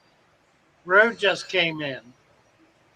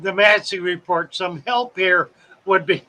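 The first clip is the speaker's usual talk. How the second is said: ordinary talk, nothing marked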